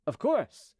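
high-pitched, obliging agreement; ready assent. A narrator's reading. Officer agreeing to open the gate, high and obliging. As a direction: agree readily, pitched well up.

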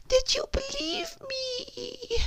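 high, soft, slow falsetto; overly kind. Shown as a demonstration. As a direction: coo it sweetly like a doormat. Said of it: "present it in such a kind way".

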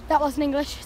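normal talking voice

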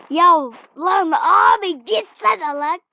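urgent yelling. Real, not acted. Urging the game character on.

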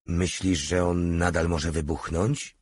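usual speaking voice

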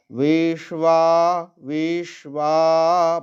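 বিশ্বা said slowly with the বা drawn out long. Shown as a demonstration. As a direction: stretch the বা in বিশ্বা. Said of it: "বাটাকে দীর্ঘ করব".

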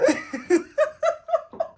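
loud high cackle of a laugh; high laugh burst. Real, not acted. Vlogger seeing her wide shoulders, high loud laugh.

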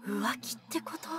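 whispered question; hushed gossip. Real, not acted. Gossiping about the affair, in a whisper. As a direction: whisper it so no one hears.

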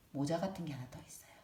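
fast soft whisper. Acted. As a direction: whisper it like a secret. Whispered aside to children.

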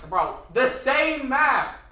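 "Bro, the same map!" yelled high and loud, dragged out slowly; exasperated slow yell at the repeat map; drawn-out disbelieving shout; outraged shout. Real, not acted.

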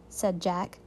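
usual speaking voice

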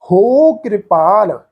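"ਹੋ" drawn out long and slow before ਕਿਰਪਾਲ. Shown as a demonstration. Teacher stretching the long vowel for the sangat. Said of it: "ਇਸ ਮਾਤਰਾ ਨੂੰ ਅਸੀਂ ਲਮਕਾ ਕੇ pronounce ਕਰਾਂਗੇ".

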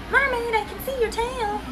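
put-on high, soft child's voice; a small child calling out softly; little kid voice. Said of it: "That kid just said".

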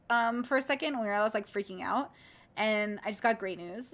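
ordinary talk, nothing marked

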